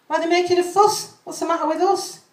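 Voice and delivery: high, loud, fussing toy voice; Teletubby voice. Acted. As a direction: plead like a bewildered toy. Teletubbies speak in poem.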